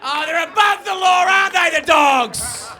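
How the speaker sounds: loud high jeering shout; loud, high, mocking yell about police. Real, not acted. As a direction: yell it at the crowd.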